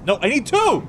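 high, urgent mad doctor cries; frantic mad scientist. Acted. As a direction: rush Igor in a panic. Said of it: "Igor, quickly".

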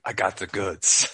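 lightly voiced street hustler, slow; shady street dealer. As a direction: offer it slowly like a sly street seller. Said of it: "There's gonna be some guy in a street".

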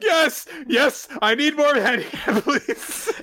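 very high, hurried mock begging; embarrassing pleading. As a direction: plead in a squeaky pitch, breaking into laughter. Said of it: "you're so embarrassing now".

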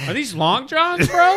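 high, loud incredulous question; mock surprise. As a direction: act shocked at the long johns. Mock shock at layers.

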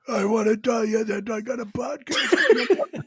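slow, lightly strained imitation of a hoarse caller, laughing; Jeremy's lost voice. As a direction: tease him by copying his croak. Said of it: "you'll be talking like this".

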